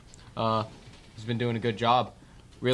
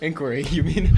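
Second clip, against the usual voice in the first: teasing laugh; 5 semitones higher, 12 dB louder than usual. Real, not acted. Teases the word inquiry.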